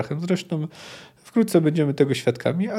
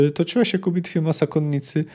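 ordinary delivery

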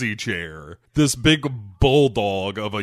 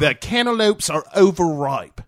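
mock Australian doctor; 5 semitones higher than usual. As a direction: lay the Aussie accent on thick. Kidman voiced in mocking Australian.

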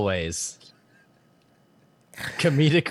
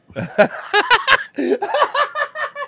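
high laugh; 6 dB louder than usual. Real, not acted. Laughing at fat joke.

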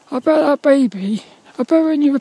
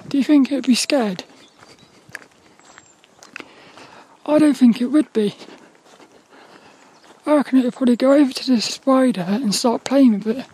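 teeth shaking from cold; teeth chattering; faster than usual. Real, not acted. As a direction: talk through chattering teeth. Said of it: "God, my teeth are shaking".

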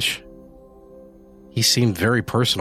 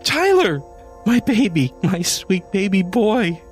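very high, louder weeping joy; tearful joyful cry; 11 semitones higher, 3 dB louder than usual. A narrator's reading. Janie clutching the kitten, crying out in joy. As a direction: cry out to the lost son found. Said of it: "she cried".

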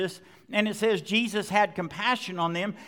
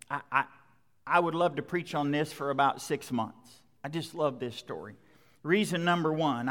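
intimate sincere preaching; 4 semitones lower than usual. Real, not acted. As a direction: lean in and confide your love of this story.

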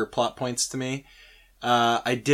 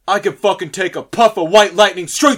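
boastful rich boss; 5 semitones higher, 6 dB louder, faster than usual. Retold or imitated.

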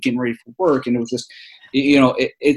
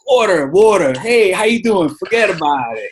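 loud, high, hammed-up New York accent; 8 semitones higher, 6 dB louder than usual. Showing off for strangers on request, New York accent. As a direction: lay the New Yorker accent on thick. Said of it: "say something in New Yorker".

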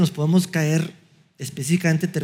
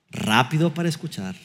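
usual speaking voice

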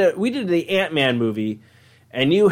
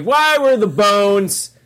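high, slow, stretched-out question; questioner's voice; 6 semitones higher, 4 dB louder, slower than usual. Acted. Asker putting a question to the vague Italian, drawn-out high voice. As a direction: pitch it up and draw out the words. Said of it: "Where it's just like".

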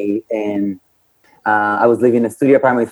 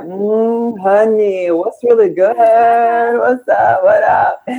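high, sassy street greeting voice, much higher; sassy LA self; 10 semitones higher, slower than usual. Retold or imitated. Guest imitating her younger LA self, high and sassy. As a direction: play her sassy new LA self, hamming it up. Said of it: "I started to be like".

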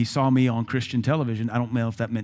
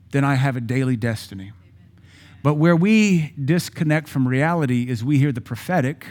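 ordinary talk

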